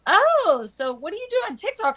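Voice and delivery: high bubbly quoted question; high mock curious voice. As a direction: play her as a chirpy, clueless questioner. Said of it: "She goes".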